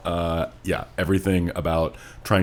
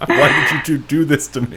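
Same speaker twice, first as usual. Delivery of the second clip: higher and laughing, playful blame; mock accusation, laughing; 8 semitones higher, 5 dB louder than usual. Teasing the two co-hosts who picked the book, laughing mock outrage. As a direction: laugh through a fake grievance at your friends.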